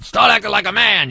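high, loud mock scold; gruff scolding Don. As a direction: bark it like the Don slapping him. Godfather slaps godson, scolding.

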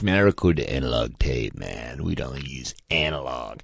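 low, soft analog-purist voice; mock analog guy. Mimicking the analog guys out there, in a low put-on voice. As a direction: drop low and play the analog devotee. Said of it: "all you analog guys out there".

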